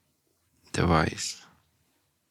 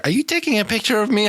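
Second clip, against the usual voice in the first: retold girl; 12 semitones higher than usual. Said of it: "She's like".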